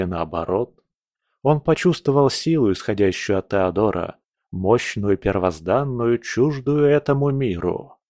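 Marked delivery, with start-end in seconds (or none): none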